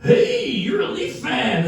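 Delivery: high, long-drawn-out delighted hail; eager old fan. Retold or imitated. Old man spots a Leaf hat on the plane, gushing. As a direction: stretch the greeting out high, like an overjoyed fan. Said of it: "And an old guy".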